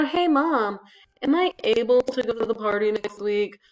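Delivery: mock a kid's stilted request to a parent; kid asking mom. Kid asking Mom about a party, loud put-on voice. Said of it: "we don't talk like that".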